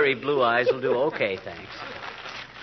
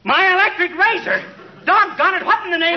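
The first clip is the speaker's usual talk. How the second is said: pitched way up, louder, sputtering rage; outraged squawk; 13 semitones higher, 4 dB louder than usual. Acted. Husband erupting at the neighbour girl, high loud outrage. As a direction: blow up in disbelief over the razor. McGee flares up at Alice.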